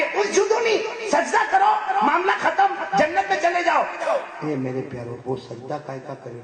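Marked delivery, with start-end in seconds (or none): 0.0-3.8 high drawn-out call; voice of God. Acted. God calling the faithful to bow, high. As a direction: stretch the first word as a divine command. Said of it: "اور اللہ کیا کہیں گے؟".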